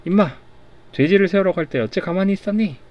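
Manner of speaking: 높고 크게 꾸짖는 호통; 화가 나서 관우를 다그쳐. Acted. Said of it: "화가 나서". (화가 나서 관우를 꾸짖는 큰 호통)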